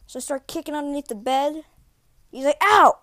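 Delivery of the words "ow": loud, high hammed-up yelp; mock pain. Dad faking being kicked under the bed, mock yelp. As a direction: fake getting hurt for laughs.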